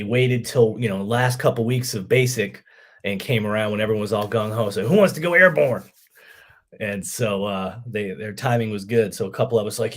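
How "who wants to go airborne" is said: higher, louder recruiter's call-out; rallying call. Retold or imitated. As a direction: imitate a recruiter rallying the troops. Recruiters rallying gung-ho trainees.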